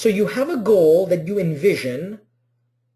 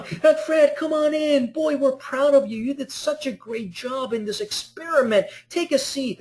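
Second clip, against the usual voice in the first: much higher, beaming welcome for the star subject; proud host; 9 semitones higher than usual. Retold or imitated. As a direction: gush over Fred like a proud host. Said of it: "You're like".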